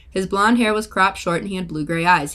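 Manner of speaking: usual speaking voice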